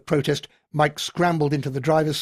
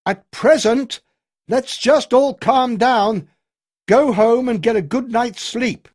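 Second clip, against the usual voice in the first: loud, higher, slowing on calm down; raised voice; 9 semitones higher, 8 dB louder, slower than usual. A narrator's reading. Colonel raises his voice to calm the pub, loud. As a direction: talk over the crowd to settle them. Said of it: "raised his voice".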